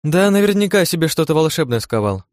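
high, lilting wry guess; wry chuckling remark. A narrator's reading. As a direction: toss it off with amused suspicion. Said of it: "усмехнулась Ирен".

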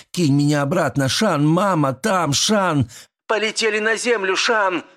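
high, urgent dream cries; crying out in sleep. A narrator's reading. Dreaming woman begs Shan to throw her back, crying out. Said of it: "Ты кричала во сне, звала".

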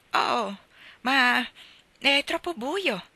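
put-on a girl balking at the dark, pleading; exclaiming. A narrator's reading. Girl protesting to Marilla about the dark, exclaiming. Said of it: "esclamò Anna".

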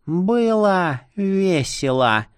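slow dying rasp; hoarse rasp. Acted. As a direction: rasp it out with last breath. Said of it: "прохрипела она".

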